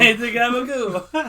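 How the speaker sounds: laughing mock Jersey. Echoing Jersey joke, laughing.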